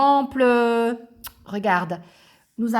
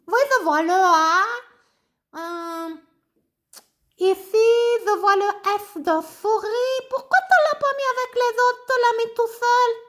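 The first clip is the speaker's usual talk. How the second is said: high, bouncy little puppet voice; high childlike puppet greeting the class; 12 semitones higher, 4 dB louder than usual. Acted. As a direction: play the cheeky puppet pupil. Ratoun puppet talking.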